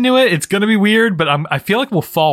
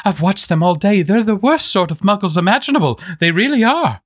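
worried McGonagall; 7 semitones higher than usual. Acted.

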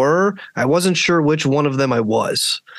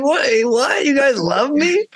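much higher, laughing, choked-up mock disbelief; touched surprise; 12 semitones higher, slower than usual. Tripping guy hearing his friends love him, high and teary. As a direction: squeak it out like you're about to cry. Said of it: "I was like".

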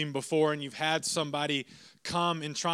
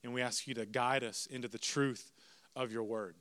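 hushed, low-pitched prayer voice; opening prayer; 5 semitones lower than usual. Real, not acted. Leads the congregation in prayer.

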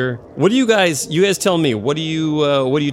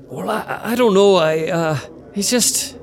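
nervous hesitant; 5 semitones higher, slower than usual. Acted.

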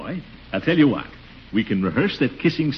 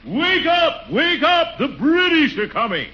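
much higher, ringing shout from the stomach; fiery shout; slower than usual. Shown as a demonstration. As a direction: rouse the whole town with fire. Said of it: "Put some fire into it".

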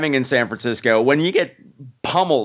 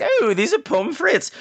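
delighted discovery; 10 semitones higher, slower than usual. Retold or imitated. Quoting the time traveller's discovery.

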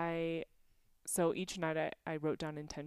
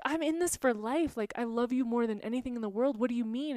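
higher and louder, swept up in disbelief; incredulous; 6 semitones higher, 6 dB louder than usual. Retold or imitated. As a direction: protest it, almost laughing in surprise. Retold reply to boyfriend's insecurity.

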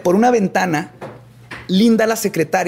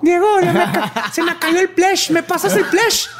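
squeaky, whiny little voice; high childish voice; 12 semitones higher than usual. Acted. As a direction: whine like a small child. Acted victim asking for toy. (voz aguda e infantil)

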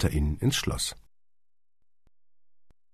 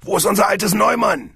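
usual speaking voice

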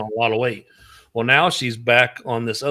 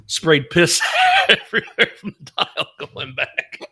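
laughing; 4 semitones higher than usual. Real, not acted. Laughing through story punchline.

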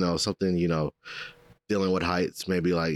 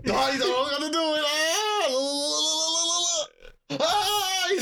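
much higher frantic crying voice; panicked; slower than usual. Acted. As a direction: scream it like you're terrified of falling. Scared reaction at glass skyscraper.

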